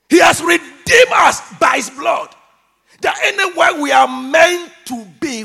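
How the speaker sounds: loud, high proclamation; full-force preaching. Real, not acted. Preacher proclaiming redemption by his blood, at full force. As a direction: cry it out to the congregation.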